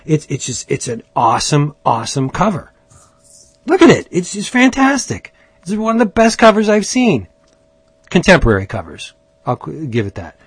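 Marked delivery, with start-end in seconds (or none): 3.7-7.3 excited gushing. Real, not acted. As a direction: pitch jumps high, eager praise. Gushing over a comic cover.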